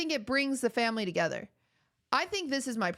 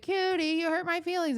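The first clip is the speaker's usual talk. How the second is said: mock hurt; 5 semitones higher than usual. Streamer imitates him taking it personally, mock hurt. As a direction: whine it higher, hamming up the hurt. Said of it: "then he'll be like".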